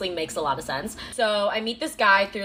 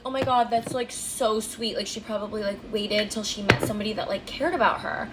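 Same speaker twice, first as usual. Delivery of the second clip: gushing imagined listener. Retold or imitated. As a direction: coo it like a naive admirer. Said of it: "you're probably thinking".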